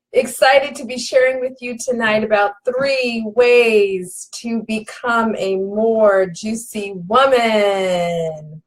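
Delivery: usual speaking voice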